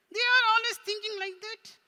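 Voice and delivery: very high, loud puppet voice; teasing puppet. Pretend. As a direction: squeal it as a mocking puppet. Puppet teases the accountants.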